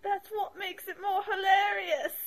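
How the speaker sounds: high, slow mock English accent; attempted English accent. Imitating the British co-host, badly faked English accent. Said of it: "I can't do English accents".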